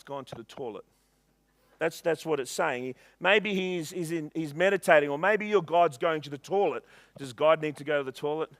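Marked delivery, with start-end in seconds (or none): none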